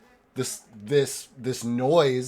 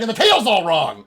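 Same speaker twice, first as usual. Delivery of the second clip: high, loud protest; insane elf; 6 semitones higher, 8 dB louder than usual. Acted. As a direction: cry out in crazed dismay. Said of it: "fucking insane elf".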